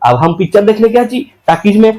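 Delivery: ordinary talk, nothing marked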